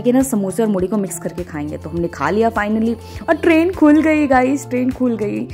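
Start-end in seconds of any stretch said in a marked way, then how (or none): 3.3-5.5 high, stretched-out cry of relief; thrilled, sing-out news to the viewers; excited announcement. Real, not acted. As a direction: announce it gleefully, easing down on the repeat. Long-stalled train finally starts moving.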